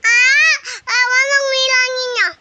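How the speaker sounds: high laughing wail; laughing cry. Real, not acted. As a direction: wail and laugh at once, loud. Reacting to sour taste.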